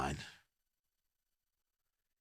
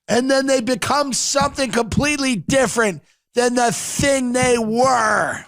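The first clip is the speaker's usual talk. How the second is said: Rick speech; 6 semitones higher than usual. Retold or imitated. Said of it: "He's like".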